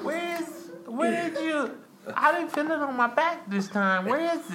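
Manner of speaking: high-pitched imitation of a confused girlfriend. Man mimicking his girlfriend, high and puzzled. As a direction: play her confused and clueless, pitch way up. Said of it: "she was like".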